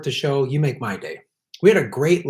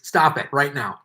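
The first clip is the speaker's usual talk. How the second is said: usual speaking voice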